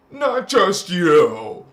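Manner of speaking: slow, loud insistence on not; slow emphatic. Real, not acted. Preaching to viewers, slow and insistent. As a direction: lean hard on each word.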